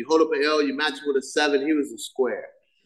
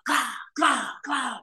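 high playful clow clow; boy on sister's bed firing cap guns; cap gun noises; 7 semitones higher, 3 dB quieter, slower than usual. Retold or imitated. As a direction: pop off toy gun sounds from the bed. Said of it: "jumping up and down on the bed going".

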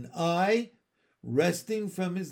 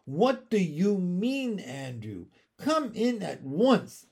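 scolding; 6 semitones higher, 3 dB louder than usual. Acted. Owner scolds dog refusing to move.

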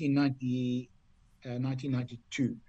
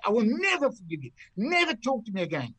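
high-pitched imitated outrage; hammed-up furious vow, pitched way up; John Bland; 8 semitones higher than usual. Friend confronts golfer in the locker room, mock furious. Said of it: "seen John Bland really angry".

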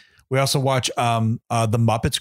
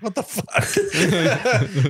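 slow, drawn-out mock disbelief, higher and laughing; dad's disbelief; 6 semitones higher, much slower than usual. Dad reacting to grown son wanting to cuddle, slow laughing disbelief. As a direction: stretch it out in amused disbelief. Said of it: "his dad's like".

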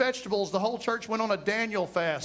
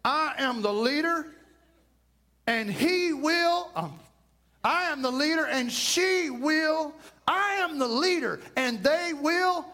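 higher, puffed-up proclaiming; strutting I am the leader, pitched up; mock self-important boast; 6 semitones higher than usual. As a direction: play the husband who insists he's the boss. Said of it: "somebody wants to raise their hand and say".

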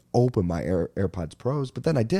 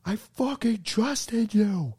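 slow, much higher mock wail; imitating screaming girlfriend; 12 semitones higher, much slower than usual. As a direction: ham up the betrayed girlfriend. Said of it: "She just goes".

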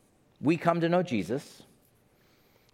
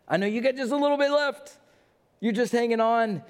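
emphatic higher repeat; 9 semitones higher than usual. Acted. Voicing Jesus, repeated louder.

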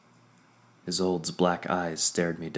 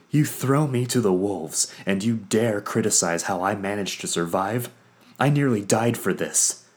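loud, higher furious outburst; 3 semitones higher, 6 dB louder than usual. Acted. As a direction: hurl it at her in outrage. Vondair furious at Isolde.

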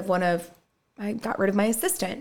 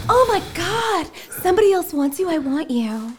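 put-on much higher, gushing girly voice; mock swooning woman; 9 semitones higher, 5 dB louder than usual. Mocking the woman who falls for jealousy, high and gushing. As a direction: ham up a smitten woman. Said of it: "no woman ever is like".

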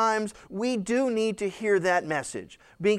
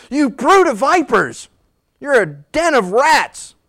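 loud, high-pitched scorn; preacher acting out a disgusted rebuke; 4 semitones higher, 7 dB louder than usual. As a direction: hurl it at them in open disgust. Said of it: "You can almost hear the disgust in his voice".

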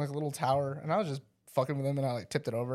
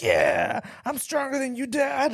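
mock cocky child; 9 semitones higher, slower than usual. Kid beats dad at wrestling, mock cocky boast. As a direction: crow it like a boastful little boy. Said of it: "this, like, cocky attitude lately".

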